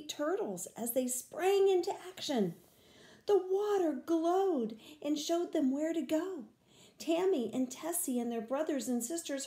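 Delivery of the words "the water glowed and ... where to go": ordinary delivery, nothing marked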